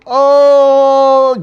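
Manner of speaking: long loud anguished oh. Real, not acted. Host reacts live to a death, long loud anguished oh. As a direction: cry out at the news.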